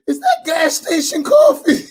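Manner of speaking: high, laughing, outraged question; appalled disbelief. Retold or imitated. Sheikh recoiling from cheap coffee, high laughing outrage. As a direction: squeal it like the coffee is an insult. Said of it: "He said".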